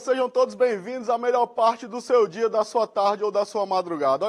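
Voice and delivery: high, loud cheerful greeting; bright sing-song welcome. Acted. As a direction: welcome the kids like a TV host. Teacher greets students, opening show.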